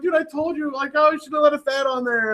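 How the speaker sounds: high, self-mocking told-you-so; mock exasperation. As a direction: tease yourself, exasperated. Self-mocking retort to hosts.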